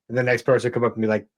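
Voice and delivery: ordinary talk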